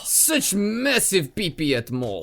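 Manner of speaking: slow, drawn-out put-on voice; goofy creature voice. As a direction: savour it like a dumb monster. Silly pee-pee bit.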